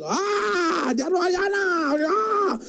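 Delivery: high mock cry of fear; hammed-up frightened shriek; mock scream. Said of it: "we're scared".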